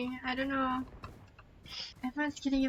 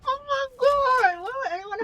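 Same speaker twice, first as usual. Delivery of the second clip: laughing cry; 10 semitones higher, 8 dB louder than usual. Real, not acted. Crying laugh at game.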